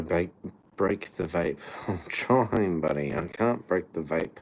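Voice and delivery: ordinary talk, nothing marked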